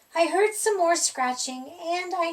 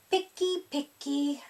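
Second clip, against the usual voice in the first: complaining; 3 dB quieter than usual. A narrator's reading. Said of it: "Herbert complained".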